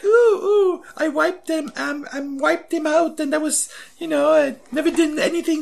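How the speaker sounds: hammed-up French heroine gloating; mock French accent. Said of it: "I'm not gonna do this voice all the time".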